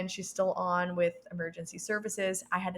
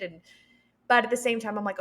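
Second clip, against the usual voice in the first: ordinary talk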